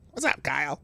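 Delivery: half-hearted cartoon impression; badly faked cartoon greeting, ending in a laugh; South Park voice. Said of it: "that wasn't a very good, uh, South Park voice".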